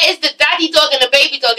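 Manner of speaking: ordinary talk, nothing marked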